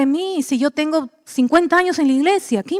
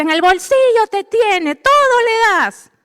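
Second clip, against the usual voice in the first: higher, teasing complaint; mock the jealous sister's tease; imitating a sister; 8 semitones higher than usual. Preacher imitating her sister teasing their father, higher. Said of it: "a Laura le decía".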